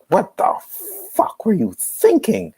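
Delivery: high, slow mock scolding; playing parents chewing out a son; imitating angry parents. Parents scolding the brother after his lineup, mock angry and high.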